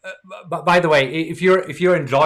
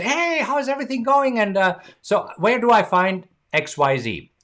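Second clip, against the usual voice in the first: cheery greeting; 8 semitones higher than usual. Retold or imitated.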